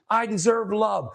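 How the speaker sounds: high, insistent declaration. Retold or imitated. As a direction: imitate a client claiming it, with extra intensity. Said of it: "I'm probably being more assertive".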